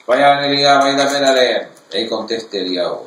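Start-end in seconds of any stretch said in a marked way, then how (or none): 0.1-1.7 loud call across a distance; shouting from afar. Retold or imitated. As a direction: call out to him from far away. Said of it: "grita de lejos". (gritando de lejos)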